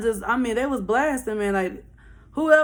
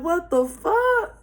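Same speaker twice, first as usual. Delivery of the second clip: slow, higher-pitched astonishment; retold disbelief; 8 semitones higher, much slower than usual. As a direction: draw it out, stunned. Both stunned at what she said.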